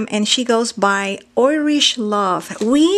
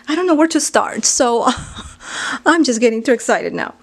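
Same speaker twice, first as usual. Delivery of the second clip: excited; 2 semitones higher, 4 dB louder, faster than usual. Real, not acted. Overwhelmed by gifts, she gushes excitedly. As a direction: bubble over with excitement.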